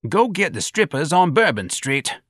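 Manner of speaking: put-on high-pitched, slow old-timer; old bystander's drawn-out high voice; old man. A narrator's reading. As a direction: heckle slowly as an old man. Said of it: "an old man added".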